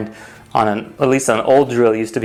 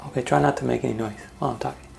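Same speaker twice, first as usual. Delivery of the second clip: quick, hushed aside to family; 10 dB quieter, faster than usual. Real, not acted. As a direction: rush it under your breath. Aside to family during recording.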